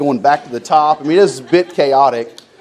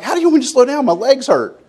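exasperated; 13 semitones higher than usual. Retold or imitated. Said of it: "And I'm like".

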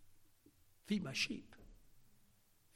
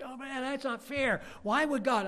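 higher, whiny protest; mock complaint; 9 semitones higher, 4 dB louder than usual. Complaining believer, higher and whiny. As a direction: complain it isn't fair.

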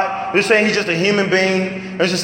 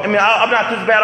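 ordinary talk

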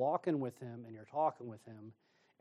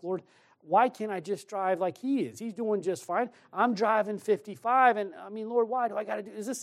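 high, whining complaint to God; frustrated complaining; 7 semitones higher, 3 dB louder than usual. Retold or imitated. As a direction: gripe like a kid who wants his way. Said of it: "I'm getting all frustrated about it".

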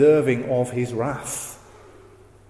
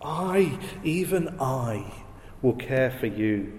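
tender promise; 4 semitones lower, 9 dB quieter than usual. Acted.

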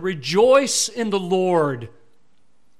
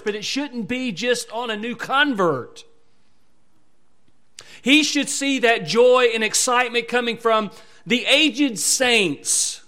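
louder, rising preacher build on joy; fervent preaching; 4 semitones higher, 5 dB louder than usual. Acted. Preacher urging old saints to show joy, louder and fervent. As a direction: preach it out to the congregation.

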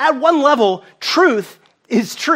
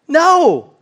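emphatic no; 7 semitones higher, 5 dB louder than usual. Real, not acted. Answering his rhetorical question.

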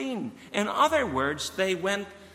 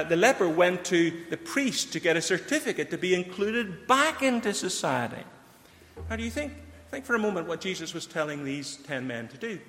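ordinary talk, nothing marked

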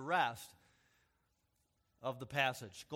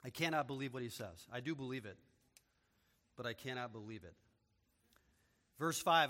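normal talking voice

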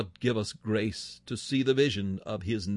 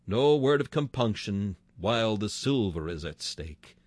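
usual speaking voice

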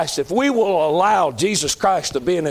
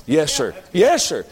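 ordinary delivery

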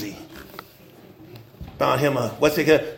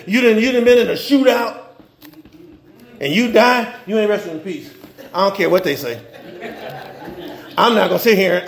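normal talking voice

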